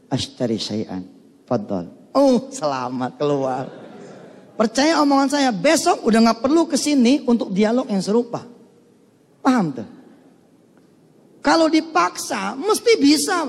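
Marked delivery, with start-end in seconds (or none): 0.1-1.9 security guard voice. Retold or imitated. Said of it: "satpam voiced in dialog". 11.4-13.5 high, emphatic insistence. Real, not acted.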